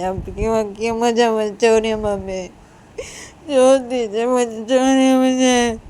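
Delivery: loud, whining protest; acted distressed outburst; struggling free. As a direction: drag out the words in upset pleading. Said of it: "Uma ऐसे छटपटाने लगती".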